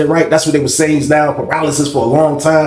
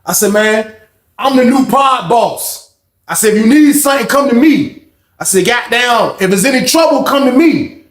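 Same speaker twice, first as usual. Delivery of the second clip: higher, louder proclamation; boastful announcement; 9 semitones higher, 4 dB louder than usual. Retold or imitated. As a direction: announce yourself boss to the whole pod. Proclaiming himself pod boss.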